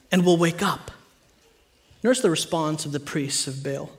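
ordinary talk

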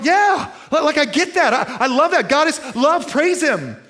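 high, loud, gushing praise; mock eager agreement. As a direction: play the eager churchgoer, voice way up. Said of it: "you're probably going".

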